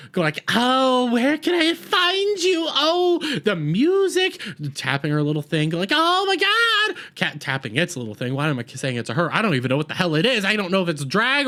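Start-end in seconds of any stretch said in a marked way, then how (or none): 0.5-4.4 very high, theatrical villain singsong; over-emoting Maestro. Acted. Maestro hunting for the doctor, over-exaggerated high theatrical voice. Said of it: "Maestro is over-emoting, over-exaggerated". 5.9-7.0 very high, drawn-out exclamation; over-emoting Maestro. Acted. Maestro tapping a little thing, over-exaggerated high cry. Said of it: "over-emoting, over-exaggerated".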